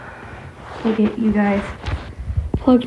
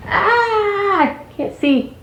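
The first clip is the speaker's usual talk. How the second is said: mock panic; 12 semitones higher, much slower than usual. Hair in eyes, joking.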